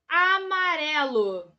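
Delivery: "amarelo" stretched long, falling slowly; drawn-out word. Shown as a demonstration. Teacher presenting the next example word, drawn-out delivery. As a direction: draw out every syllable of "amarelo".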